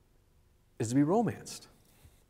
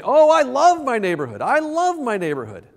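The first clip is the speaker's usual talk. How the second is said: higher, louder gushing declaration; grand gesture; 9 semitones higher, 8 dB louder than usual. Pretend. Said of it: "grand gesture saying".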